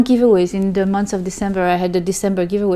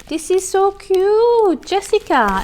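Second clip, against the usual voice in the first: delighted cooing; 10 semitones higher, slower than usual. Real, not acted. Opening a gift package.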